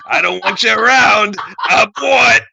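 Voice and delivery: high, loud hammed-up shout; villain's shouted dismissal. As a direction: banish them with a yell.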